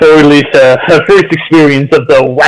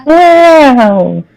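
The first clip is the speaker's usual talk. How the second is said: high stretched wow; amazed wow; 13 semitones higher than usual. Shown as a demonstration. Joining in the wow sound.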